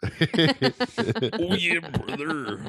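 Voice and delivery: drawn-out wrestler growl, starting high; slow, hammed-up wrestling catchphrase; Hulk Hogan. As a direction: stretch it out like a hyped-up wrestler. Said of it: "by the name of Hulk Hogan".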